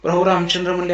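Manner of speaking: normal talking voice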